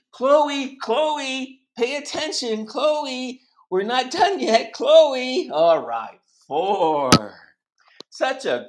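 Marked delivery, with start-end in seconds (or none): 0.1-5.5 high, loud calling of a name; raised, insistent teacher voice; calling for attention. Real, not acted. Teacher calling an inattentive child.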